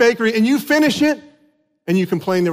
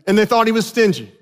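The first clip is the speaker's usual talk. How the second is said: ordinary talk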